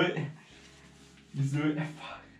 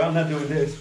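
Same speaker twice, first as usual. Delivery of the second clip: usual speaking voice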